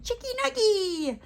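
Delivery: name her like Yoda would, way up high; Yoda-style naming. Retold or imitated. A hint of Yoda naming the niece, very high. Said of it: "the whole Yoda thing".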